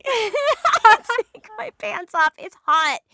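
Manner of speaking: high, loud, laughing; laughing high squeal. Retold or imitated. Laughing repeat of the pants line.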